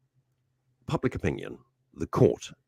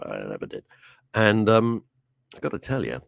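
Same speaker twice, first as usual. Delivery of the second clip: ordinary talk, nothing marked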